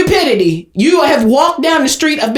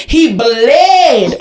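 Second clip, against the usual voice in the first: "bled" louder and drawn out; stress on BLED; 4 dB louder than usual. Real, not acted. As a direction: punch the word "bled" hard. Insists on the word bled.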